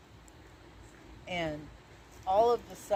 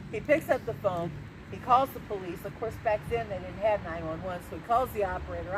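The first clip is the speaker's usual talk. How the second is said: usual speaking voice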